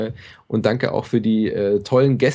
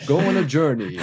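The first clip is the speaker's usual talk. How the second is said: movie trailer voice; 4 semitones higher than usual. Pretend. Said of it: "mit dieser Trailerstimme".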